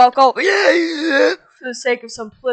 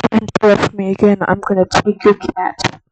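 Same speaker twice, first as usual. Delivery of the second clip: fast, louder mock-menacing warning; mock threat; 2 semitones lower, 6 dB louder than usual. Hammed-up threat to the friend who keeps interrupting. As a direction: threaten him, half in jest.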